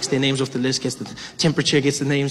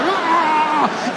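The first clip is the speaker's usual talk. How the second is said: high frightened yelp; 13 semitones higher than usual. Retold or imitated. Passenger recoils from cough.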